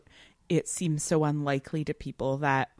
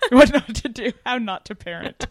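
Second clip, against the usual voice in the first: laughing; 7 semitones higher, 6 dB louder than usual. Real, not acted. Echoing co-host's joke, laughing.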